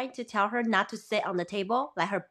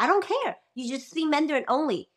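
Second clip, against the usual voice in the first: firm insistence; 6 semitones higher than usual. Retold or imitated. Mother overruling puzzled daughter, retold.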